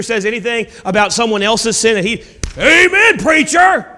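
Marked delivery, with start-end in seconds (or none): 2.6-3.9 high, loud showy amen; hammed-up holier-than-thou shout of agreement. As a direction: play the eager front-row zealot.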